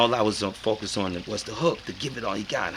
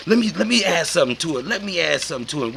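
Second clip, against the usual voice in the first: high, fast eager plea; eager friends; 7 semitones higher, 5 dB louder, faster than usual. Retold or imitated. Friends wanting a turn, fast eager voices. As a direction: jump in eager to add something. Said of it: "they was like".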